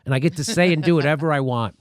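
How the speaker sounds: ordinary talk, nothing marked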